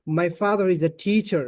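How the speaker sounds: slow American English with thick r sounds; thick-voiced American r in a model sentence; American accent. Shown as a demonstration. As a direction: demonstrate an American accent, heavy on the r. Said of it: "আর যদি American বলে".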